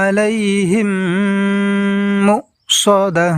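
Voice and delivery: slow melodic recitation; Quran recitation. Shown as a demonstration. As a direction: chant the verse beautifully and slowly. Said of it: "এই আয়াতটি আমরা সুন্দরভাবে এইভাবে পড়ব". (সুন্দরভাবে ধীর তেলাওয়াত)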